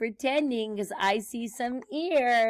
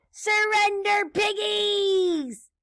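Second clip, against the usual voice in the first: high, ringing witch's cry; cackling witch; 10 semitones higher, 7 dB louder, slower than usual. Acted. Witch circling over the piggies, high gloating shout. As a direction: call it out high, drawing out the last word. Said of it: "she cackled as she circled overhead".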